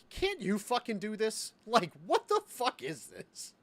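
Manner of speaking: high, slow disbelieving complaint; mocking the clerk's answer, high and slow with disbelief; incredulous rant. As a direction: spell out your disbelief slowly. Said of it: "And I was like".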